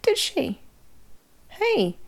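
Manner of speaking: high, whispered mock surprise; a preacher's high, hushed pretend astonishment; father's mock surprise. Father hushed and high, quizzing the boy in church.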